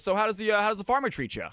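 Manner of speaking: high, chatty showman voice; ventriloquist voice. Acted.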